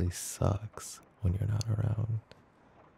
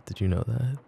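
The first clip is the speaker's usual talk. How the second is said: normal talking voice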